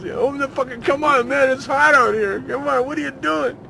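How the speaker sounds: very high, loud frantic yelling; going nuts. Retold or imitated. Dave stuck outside in the heat, frantic yell. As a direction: lose it, pleading and furious. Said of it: "then he went nuts".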